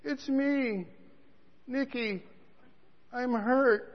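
a hint of a hurt young man; little voice. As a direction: sound weak and injured. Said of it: "I hear this little voice".

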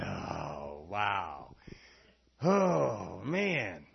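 low, drawn-out wow; hushed, low mock awe; retold amazement. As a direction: sigh out the words in slow amazement. Retold relief on learning they're siblings.

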